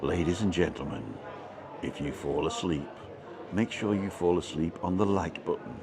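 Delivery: hushed late-night radio calm; relaxing voice. Acted. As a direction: play up a soothing voice to send listeners to sleep. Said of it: "a very relaxing voice".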